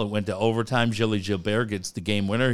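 normal talking voice

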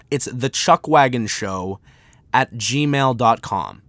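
slow and clear for writing down; dictating an email address at x0.6; slow dictation. Shown as a demonstration. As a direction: spell it out slowly. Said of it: "here is the email".

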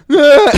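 loud high shriek of "Gah!"; mother's war cry. Retold or imitated. Deaf mom kicking the bathroom door in, shrieking. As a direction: charge in screaming. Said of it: "She's deaf".

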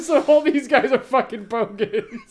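loud, high, words through laughter; laughing hard. Real, not acted. Cracking up with co-host at the bogan definition, real laughter. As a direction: crack up while getting the line out.

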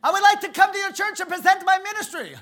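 very high, loud, bursting with excitement; excitable Brother Vic. Retold or imitated. Imitating Brother Vic on the phone, high and loud. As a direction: boom it out like the most excitable guy alive. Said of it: "the most excitable guy I ever met".

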